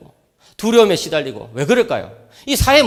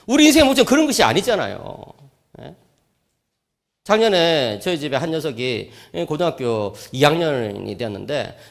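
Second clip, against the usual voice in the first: ordinary talk, nothing marked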